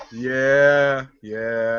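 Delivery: low, sinking, dejected yeah; imitating a sad yeah. The friend answering very sadly, mock dejected. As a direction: let the yeah sag like she's let down. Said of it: "very sadly".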